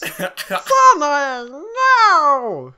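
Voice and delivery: high, loud screech of "Sanae, no!". Acted. Reimu screeching as Sanae is dismembered, high and loud. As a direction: scream her name in horror. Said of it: "screeched Reimu".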